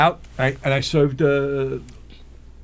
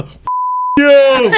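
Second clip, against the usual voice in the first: high, loud parting yell; yelled curse; 7 dB louder than usual. Retold or imitated. Driver yelling at the witness while fleeing, loud. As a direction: hurl it out the window. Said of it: "I yell up in the window".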